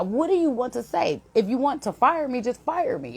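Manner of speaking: high-pitched, fed-up challenge; exasperated retort. Retold or imitated. Upset worker to colleague chasing her at day's end, fed-up retort. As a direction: dare them, completely fed up.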